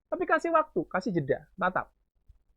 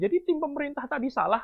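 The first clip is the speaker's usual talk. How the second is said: higher-pitched, dismissive debater's jab; belittling; 7 semitones higher than usual. Shown as a demonstration. Debater belittles the government team, mock dismissive. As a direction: put the opposing team down lightly. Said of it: "yang misal menyepelekan".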